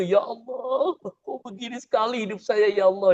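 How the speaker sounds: mock crying prayer. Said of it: "makin nangis tuh".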